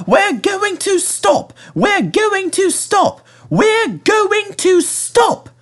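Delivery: very high, slowing chant; drawn-out squeaky chant; coaches braking. A narrator's reading. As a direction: carriages dragging to a halt. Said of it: "they said".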